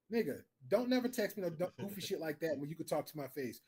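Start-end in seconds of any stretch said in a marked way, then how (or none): none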